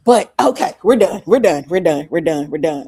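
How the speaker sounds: loud repeated cut-off, fading to normal; emphatic cutting off. Real, not acted. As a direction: burst out loudly, then tail off with each repeat. Abruptly shutting down the comic bit.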